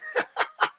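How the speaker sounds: a quick high burst of real laughter; brief amused chuckle; short laugh. Real, not acted. Laughs at his own flight joke.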